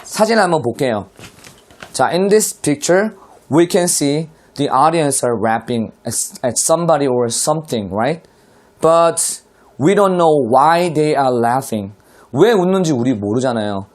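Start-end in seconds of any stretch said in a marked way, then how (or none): 2.1-11.9 very slow, careful English read-aloud. Shown as a demonstration. Teacher reading the caption slowly to students. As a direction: read each word slowly for the class. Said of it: "teacher reads example slowly".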